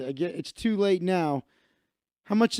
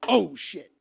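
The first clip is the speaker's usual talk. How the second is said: sudden higher yelp of dismay; startled; 4 semitones higher than usual. Real, not acted. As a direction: blurt it out as your drink spills. Just spilled beer while talking.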